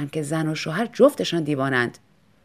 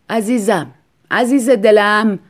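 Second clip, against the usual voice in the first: tender plea; 8 dB louder, slower than usual. Acted. Husband worried, loving.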